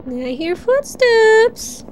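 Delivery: high, slow eerie singsong; spooky singsong. Pretend. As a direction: draw it out like a ghost story.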